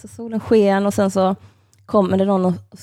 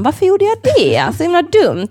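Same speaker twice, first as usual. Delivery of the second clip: high, louder self-scolding; exasperated at herself; 10 semitones higher, 5 dB louder than usual. Real, not acted. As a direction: groan at your own silly mistake. Scolding herself for the awkward chat. (irriterad på sig själv, högt och ljust)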